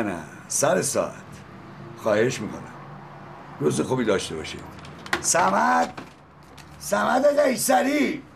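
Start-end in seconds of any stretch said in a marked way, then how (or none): 5.2-8.2 high, slow, drawn-out calling of a name. Acted. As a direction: summon him across the room. Calling the driver to work.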